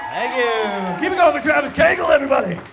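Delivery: high, loud hype-man shout; shouted thanks to the emcee. Real, not acted. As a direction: pump up the crowd for the host. Comic greets crowd, hypes host.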